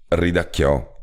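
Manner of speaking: ordinary delivery, nothing marked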